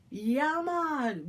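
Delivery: mocking how outsiders drag out Yeah, man; drawn-out fake patois. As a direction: overdo Yeah, man like a tourist would. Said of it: "we don't draw it out like that".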